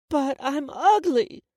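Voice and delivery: high, loud, slow wail of words; hurt child's protest. Acted. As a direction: drag it out like an upset little girl. Hurt young girl protests.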